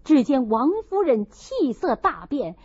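ordinary delivery

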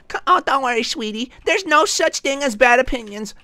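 high, cooing caricature of a comforter; mocking sweetie-talk, high-pitched; mock consoling voice. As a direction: coddle in a sugary high put-on voice. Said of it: "You know when people tell you".

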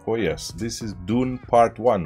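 ordinary talk

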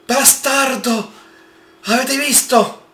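high, slow, shaken outburst; shaken exclamation. Real, not acted. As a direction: still rattled by the fright. After the scare.

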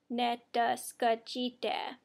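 Netaskachite said slowly, syllable by syllable; slow careful repeat. Shown as a demonstration. Teacher repeats the Sauk for I'm impatient slowly. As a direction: Netaskachite slowed right down, ne-tas-ka-chi-te. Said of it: "I'm impatient".

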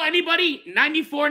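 ordinary delivery

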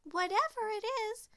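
very high, urgent exclamation; high-pitched excited call; Lil's cry. A narrator's reading. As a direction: cry out to the others. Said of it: "cried Lil".

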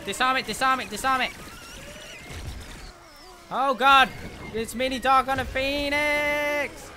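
high urgent yelling; panicking gamer. Real, not acted. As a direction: yell for help in alarm. Urgent calls to team.

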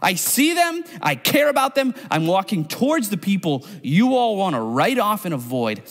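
high, bold defiant declaration; Jesus. Retold or imitated. Jesus answering the Pharisees, high and defiant. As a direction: brush off the critics with passion. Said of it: "And Jesus comes and says".

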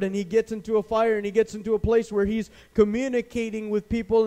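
loud, high-pitched sermon delivery; fervent, driving pulpit storytelling; preaching at full force. Real, not acted.

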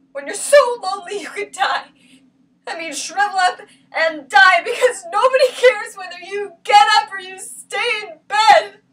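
loud, high, near-crying plea; anguished outburst. Acted. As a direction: cry it out loudly, rising in despair. Acted outburst about loneliness.

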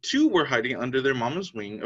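usual speaking voice

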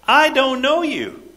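high, drawn-out dismissal; a stern judge waving them off; a hint of the Lord turning someone away; the Lord rejecting. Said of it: "And he'll say".

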